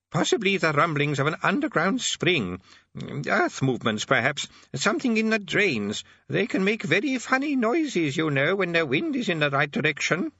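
put-on vague, wandering old man; old wizard voice. A narrator's reading. Old Arch-Chancellor speaks.

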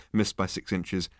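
ordinary delivery, nothing marked

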